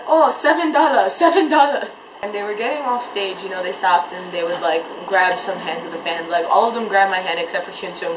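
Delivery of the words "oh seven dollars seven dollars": high, loud excited imitation; Dongwon. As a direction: exclaim it in a raised, mimicking voice. Imitating Dongwon showing off his money.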